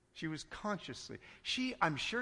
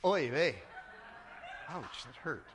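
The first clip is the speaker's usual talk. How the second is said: put-on Yiddish accent; 4 semitones lower than usual.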